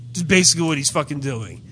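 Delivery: ordinary talk, nothing marked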